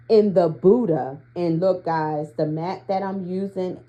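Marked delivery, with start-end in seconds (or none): none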